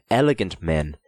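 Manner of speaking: plain reading voice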